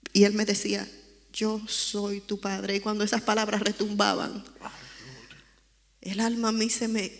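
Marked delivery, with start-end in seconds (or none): 1.3-2.8 slow, soft and gentle, half-whispered. Retold or imitated. God's soft voice answering the weeping girl, gentle and slow. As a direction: speak it like a tender father in her ear. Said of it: "una voz muy suave".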